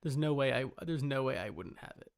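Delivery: ordinary talk, nothing marked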